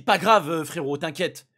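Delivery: loud, careless mock taunt; mocking dismissive. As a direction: brush it off with scorn. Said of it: "Yanis, il a dit".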